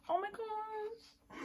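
slow, high awestruck voice; drawn-out high gush. Real, not acted. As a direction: stretch it out in awe. Overwhelmed by photo.

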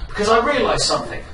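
usual speaking voice

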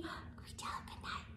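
fast hushed question; whisper. Real, not acted. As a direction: whisper quickly so he stays asleep. Teller whispers to children.

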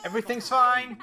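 high, loud, strained insistence; mounting panic. Pretend. Gamer insisting everything's fine amid chaos, panicky. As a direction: repeat it as control slips away.

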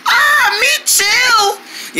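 very high whining moan; mock pain wail. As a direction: wail as if badly hurt, hammed up. Pretend broken bones after making letters.

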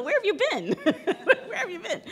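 high, laughing "where have you been?"; mock disbelief. To the naive actor, high laughing incredulity. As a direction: toss it back in laughing disbelief. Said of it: "just go".

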